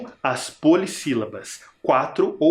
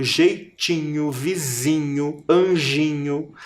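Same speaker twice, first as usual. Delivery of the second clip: jeitinho, vizinho, anjinho read slowly; slow rhyme list; slower than usual. Shown as a demonstration. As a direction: draw out every inho word. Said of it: "A vogal mais forte é o I".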